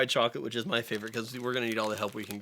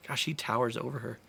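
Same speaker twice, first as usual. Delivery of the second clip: ordinary talk, nothing marked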